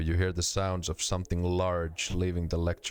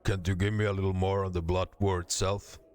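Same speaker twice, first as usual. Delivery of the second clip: ordinary delivery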